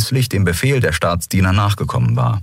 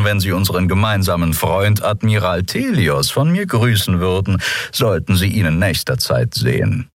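dry, measured officer's voice; formal Captain Quudal. Acted. Captain Quudal takes formal leave. (förmliche, gemessene Kapitänsstimme)

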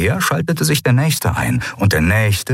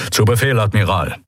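grumbled. A narrator's reading. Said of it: "brummte die Stimme".

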